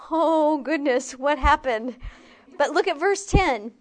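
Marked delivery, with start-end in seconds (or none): 0.1-1.9 louder, slowed mock shock at the mirror; mock dismay. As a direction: gasp at your own reflection, hammed-up. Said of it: "we look in the mirror ... we're like".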